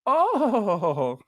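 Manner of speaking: high, wavering drawn-out "oh"; lady caught off guard. Retold or imitated. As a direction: imitate a flustered royal reaction. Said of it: "and she was just like".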